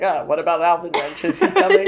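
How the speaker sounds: laughing while mimicking the grumpy neighbour; mock gruff grump. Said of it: "He's like".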